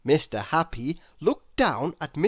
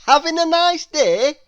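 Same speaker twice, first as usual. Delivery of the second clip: higher, louder bright hello; cheery grinning greeting; 11 semitones higher, 8 dB louder than usual. Acted. Mister Happy greeting Mister Grumpy with a grin. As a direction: beam it out in a happy character voice. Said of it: "grinned Mister Happy".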